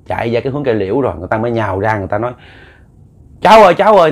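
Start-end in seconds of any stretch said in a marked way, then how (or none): 3.4-4.1 high, loud calling out; loud shouting. Retold or imitated. Neighbours shouting to pull the younger brother back, loud. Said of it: "người ta kêu um sùm lên".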